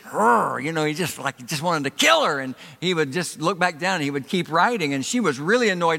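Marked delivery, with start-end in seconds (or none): 0.0-0.6 imitated husband's angry growl; mock growl. Husband looking up at his wife, imitated angry growl.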